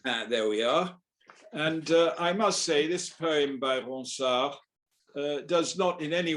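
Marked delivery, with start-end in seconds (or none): none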